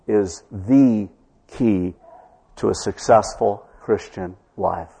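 "the" said as a full "thee", slowly set apart; stress on THE. Real, not acted. Preacher making a bold statement, stress on "the". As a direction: lean on "the", then pause around "key". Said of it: "I would underline the word "the".

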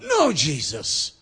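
slow, loud, emphatic protest; crying out in protest. Acted. Peter objecting to talk of crucifixion, crying out. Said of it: "cries out".

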